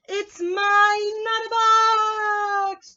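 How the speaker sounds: high, loud singsong claim; triumphant shout. Acted. Rabbit shouts at the other rabbit, loud and high. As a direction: belt it out like a proud little bunny.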